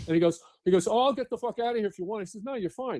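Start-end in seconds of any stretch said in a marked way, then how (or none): 0.9-2.2 high, rushed mock offer to leave; imitating the chief. Chief offering to step aside, imitated in a high quick voice. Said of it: "like this". 2.4-3.0 high, softer quick aside; imitated reassurance. Firefighter answering his chief, imitated high soft reassurance. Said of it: "like this".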